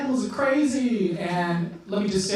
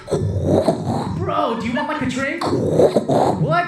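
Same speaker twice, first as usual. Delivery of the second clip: high yell over club music; loud nightclub shouting; 6 semitones higher, 6 dB louder, much slower than usual. Acted. Clubber offering a drink, shouting over loud music. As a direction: strain to be heard over a booming beat. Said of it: "loud experience that just goes like".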